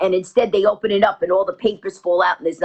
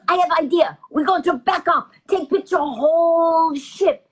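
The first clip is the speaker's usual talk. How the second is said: put-on Japanese accent; 7 semitones higher than usual. Japanese passenger proposing another photo, high accented voice. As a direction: imitate a scheming Japanese woman, pitched high. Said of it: "So she goes".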